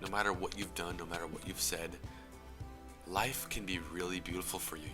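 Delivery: ordinary talk, nothing marked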